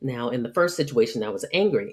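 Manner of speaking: ordinary delivery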